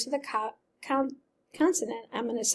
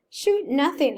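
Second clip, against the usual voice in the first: a sharp, louder retort; snapped; 5 semitones higher, 5 dB louder than usual. Acted. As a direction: bite the words off in irritation. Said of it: "I snapped".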